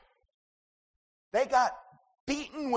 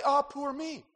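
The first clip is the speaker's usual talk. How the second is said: higher, pitiful sigh of poor me; self-pitying whine; 6 semitones higher than usual. Retold or imitated. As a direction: sigh it like you feel sorry for yourself. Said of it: "Don't say".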